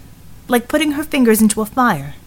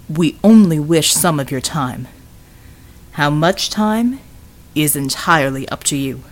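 lower, measured recruiter voice; courteous Bulwark; 5 semitones lower than usual. Acted. Bulwark asking Vivian for her time, courteous and measured. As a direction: make the polite request low and unhurried.

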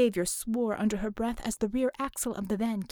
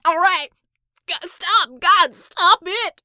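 high, loud pleading screams; desperate, pained yelling; agonised shouts; 8 semitones higher, 13 dB louder, slower than usual. A narrator's reading. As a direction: beg at a full shout for the pain to stop. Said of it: "His shouts trailed away".

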